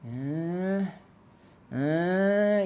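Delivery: long rising "uh"; questioning tone. Shown as a demonstration. Driver wavering at the yellow light, questioning rise. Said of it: "question is yellow tone".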